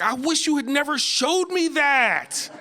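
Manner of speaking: high, slow, mock-angry complaint; mock mad. Comic mock-furious at roommate over delicious Pop-Tart, high and slow. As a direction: drag it out high in fake outrage. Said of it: "I was just mad at her".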